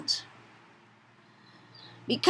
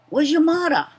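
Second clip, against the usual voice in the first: Hawaiian Pidgin; 6 semitones higher than usual. Shown as a demonstration. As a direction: ask it like a local Hawaii Pidgin speaker. Demo of Pidgin phrase.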